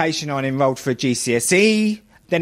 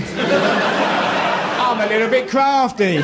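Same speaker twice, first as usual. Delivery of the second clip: slow, cheeky higher Cockney wink; crafty Cockney; 7 semitones higher, much slower than usual. Retold or imitated. Eric Bristow admitting his cunning, slow cheeky Cockney voice. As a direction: drawl it out like a sly Cockney, lightly. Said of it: "He used to call himself the crafty Cockney".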